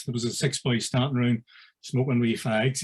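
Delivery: normal talking voice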